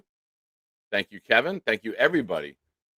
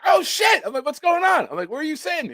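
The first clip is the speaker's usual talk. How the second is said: much higher, excited retold reaction; starstruck surprise; 3 dB louder, faster than usual. Streamer retelling meeting a player in Miami, high excited. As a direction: replay the fan-meets-player moment, giddy.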